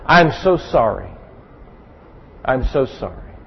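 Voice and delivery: put-on tender repeated apology to a punished child. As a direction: say it gently to the child, full of regret. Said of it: "usually I'll say at that point".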